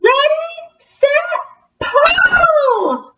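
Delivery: high, shouted count-off; loud bat call. Acted. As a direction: call them to pull together. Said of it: "called the bat".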